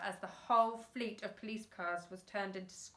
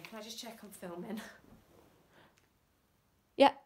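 plain reading voice

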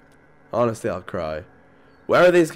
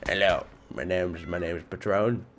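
softer, lower, stuffed-up nose; blocked-nose voice; 7 semitones lower, 9 dB quieter than usual. Shown as a demonstration. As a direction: talk like your nose is blocked by a cold. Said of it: "my nose is blocked and it's all like".

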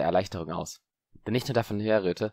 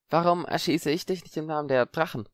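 voiced wary Parvati; 8 semitones higher than usual. Parvati, suspicious.

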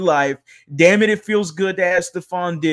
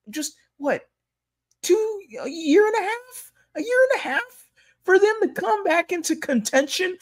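high-pitched mock disbelief; incredulous; 13 semitones higher than usual. Real, not acted. Host marvelling at the team's quick comeback, incredulous and high.

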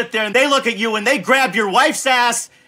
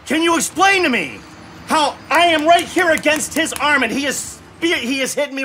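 raised, higher indignant demand; exasperated yelling; 4 semitones higher, 3 dB louder than usual. Real, not acted. Gamer demanding answers from the developers, indignant yelling. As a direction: demand an explanation at raised volume. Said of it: "Now I'm yelling".

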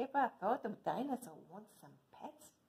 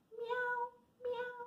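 much higher cute mewing; cat meow. Acted. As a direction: mew like a little cat. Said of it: "Cat says".

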